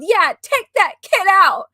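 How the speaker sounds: loud, slow mock yell; hockey dad barking from the stands; retold yell. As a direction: shout it like a rowdy hockey dad.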